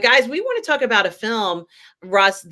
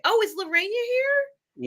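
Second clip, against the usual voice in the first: high, slow, surprised call-out; high delighted "oh, is she here?"; delighted surprise; 14 semitones higher than usual. Real, not acted. Spots a viewer in the chat.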